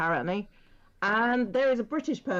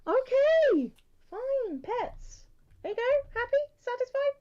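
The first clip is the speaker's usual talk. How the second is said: high pet voice; 14 semitones higher, slower than usual. Pretend. Talking to pets in put-on voice.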